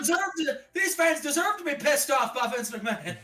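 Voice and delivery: high voice, laughing through it; laughing commentator. Retold or imitated. Co-host plays commentator, laughing in a high voice. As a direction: announce it while cracking up.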